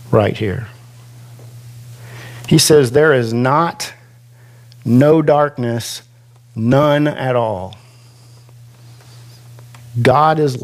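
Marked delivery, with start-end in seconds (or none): 2.9-7.8 slow, each negative weighted; emphatic triple negative. Shown as a demonstration. Preacher to congregation, slow emphatic triple negative. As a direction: lean on not, no and none, one by one. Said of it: "that's emphatic".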